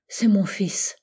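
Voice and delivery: breathy whisper, voice dropped away; whispered confession. Acted. Blanche confessing to the narrator that he is her son, whispered. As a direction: let the words fall out in a whisper. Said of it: "avec une voix que j'entends encore".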